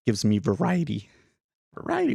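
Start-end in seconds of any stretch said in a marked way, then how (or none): 1.7-2.1 voice cracking. Real, not acted. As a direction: voice cracks up high on Variety. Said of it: "Why is my voice cracking".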